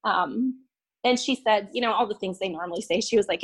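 ordinary delivery

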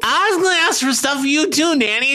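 put-on high, hurried kid protest; defensive boy Peter. Boy excusing himself to his nanny, hurried and defensive.

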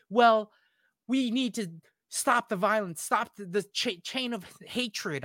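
hammed-up earnest pacifist; mock preachy heroine. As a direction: lecture about peace in a prim voice. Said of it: "it's just like".

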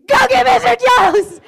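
very high, loud panicked yell; retold scream. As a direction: scream for backup, comically desperate. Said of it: "And I screamed".

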